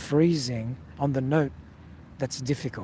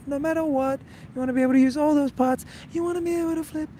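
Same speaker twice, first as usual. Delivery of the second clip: high voice; 12 semitones higher, faster than usual. Shown as a demonstration. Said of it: "use all parts of your voice".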